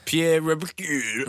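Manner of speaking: exaggerated French accent, drawn out; mock French accent. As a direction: ham up the French name. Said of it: "like, in a French accent".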